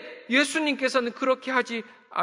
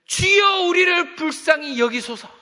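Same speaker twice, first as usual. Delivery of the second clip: loud, high pleading cry; a blind beggar crying out loud for mercy; 6 semitones higher, 7 dB louder, slower than usual. Retold or imitated. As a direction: call out desperately to be heard. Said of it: "막 소리를 지르기 시작했습니다".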